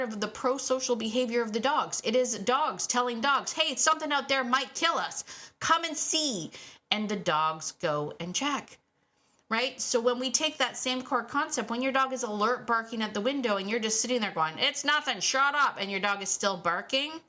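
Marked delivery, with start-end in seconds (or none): 3.5-6.5 urgent dog alarm. Retold or imitated. Dogs warning dogs, voiced. 14.6-15.8 dismissive owner. Retold or imitated. Owner dismissing barking dog.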